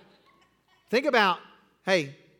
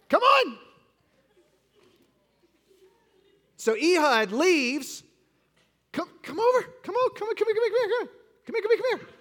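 calling over; 13 semitones higher than usual. Real, not acted. Pastor calling the child back to the stage, high calls.

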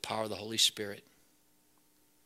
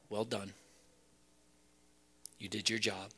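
soft, tender; 2 semitones lower, 7 dB quieter than usual. Pretend. Imagined words of Jesus.

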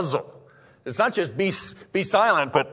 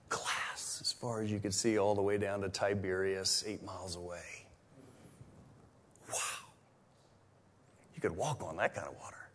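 breathy awed whisper of stillness; 7 semitones lower, 12 dB quieter than usual. Acted. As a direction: let the hush fall after the storm. Describing mirror-calm sea.

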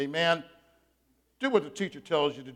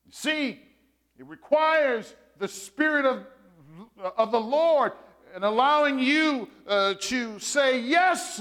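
raised, emphatic sermon delivery with long pauses; preaching; 7 semitones higher, 4 dB louder than usual. Acted. Pastor preaching to his congregation, emphatic and raised. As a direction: call out to the congregation, drawing them in.

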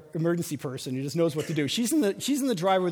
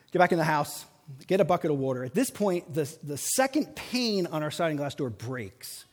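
ordinary talk

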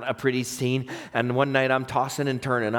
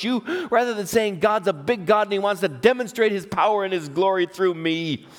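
high-pitched, emphatic preacher delivery; urgent pulpit exhortation; forceful preaching; 7 semitones higher than usual. Acted. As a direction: drive each phrase home to the congregation.

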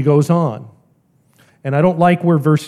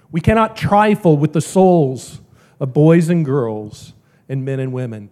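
usual speaking voice

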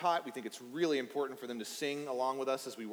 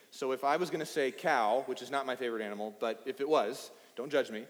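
ordinary talk, nothing marked

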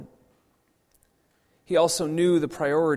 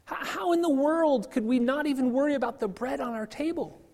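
higher, incredulous complaining voice of the crowd; grumbling disciples; 9 semitones higher than usual. Retold or imitated. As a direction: act out the disbelieving followers, pitched up.

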